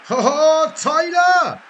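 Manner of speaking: loud high shout of Tyler; sudden loud call to a friend. Real, not acted. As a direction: yell across the room for Tyler. Calling out to someone.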